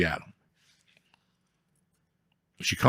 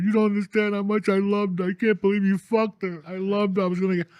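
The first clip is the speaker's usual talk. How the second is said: mock tearful, pitched way up; imitating crying; 10 semitones higher than usual. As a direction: whine it out like a heartbroken guy begging. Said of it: "This kid started crying".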